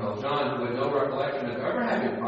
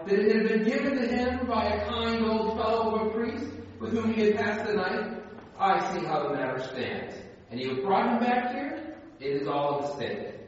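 put-on gentle old bishop's voice pitched higher; the kindly bishop; 7 semitones higher than usual. Pastor reading the bishop's lines to the thief, higher voice. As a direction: voice the bishop offering the candlesticks.

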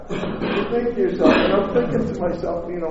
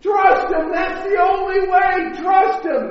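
urgent, high-pitched shouting; screaming at the TV; 11 semitones higher, 5 dB louder than usual. Acted. Moviegoers telling the characters what to do, screaming. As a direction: yell it at the characters on screen, loud and high. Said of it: "we'd be screaming at the T V".

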